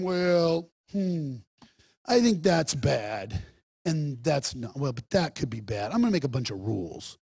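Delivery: low, soft, deliberating voice; hammed-up musing, hesitant and quiet; mock pondering. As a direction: play a ponderous rule-maker thinking aloud. Mocks God pondering rules.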